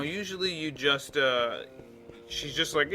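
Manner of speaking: ordinary talk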